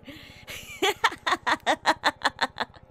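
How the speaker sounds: high villain giggle; evil laugh. Acted. Streamer's creepy character laughs at the viewer. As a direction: chuckle like a creep. Cackle after creepy threat.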